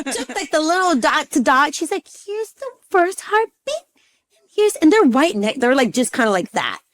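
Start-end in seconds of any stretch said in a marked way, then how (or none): 2.3-4.8 high-pitched imitation of doctor; doctor pointing out. Doctor showing patient the heartbeats, high-pitched. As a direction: lift it high, presenting each find.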